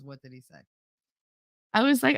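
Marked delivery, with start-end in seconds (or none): 0.0-0.6 very low, hushed question; imitated stern stepfather. Stepfather at dinner questions child's question, imitated quiet sternness. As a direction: drop it low and barely voice it. Stepfather puts cutlery down, asks.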